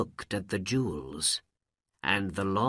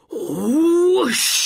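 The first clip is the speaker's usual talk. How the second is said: high, loud whooshing rush; magic whoosh; 12 dB louder than usual. Acted. Genie bursting from the rubbed lamp, loud whoosh.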